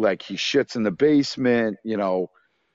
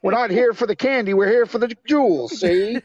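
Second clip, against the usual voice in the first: hammed-up 1930s mobster voice, high; old-time gangster; 8 semitones higher than usual. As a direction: play a cartoon gangster barking orders. Playing old-time gangster burglars.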